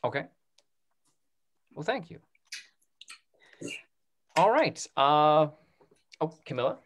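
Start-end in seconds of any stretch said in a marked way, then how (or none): none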